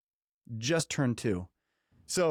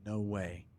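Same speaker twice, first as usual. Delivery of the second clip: soft, lower, awed aside; hushed disbelief; 4 semitones lower, 8 dB quieter than usual. Retold or imitated. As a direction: drop to an amazed hush. Retold awe at son's success.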